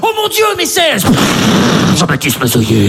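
mock dramatic announcement; dramatic reveal. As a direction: play it like a movie reveal. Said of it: "film de flic".

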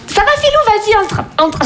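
sœur épuisée. Acted. Sœur jouée, accueil aigu.

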